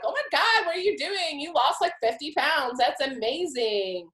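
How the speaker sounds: high-pitched mock amazement; imitated gushing. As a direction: gush like an impressed fan. Imitates admiring people's gushing reaction.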